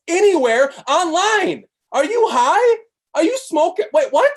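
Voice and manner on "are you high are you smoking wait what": high, louder incredulous jab; mock disbelief. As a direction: act shocked anyone would doubt it. Mocking disbelief at cheap price.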